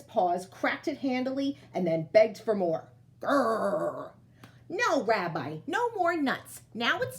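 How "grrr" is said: low bear growl. Acted. Bear begs for nuts.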